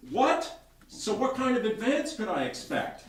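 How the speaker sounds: high, loud disbelieving burst; John can't believe the price, loud; loud shocked question. A narrator's reading. John reacting to the fifty thousand fee, loud and shocked.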